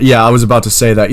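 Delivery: normal talking voice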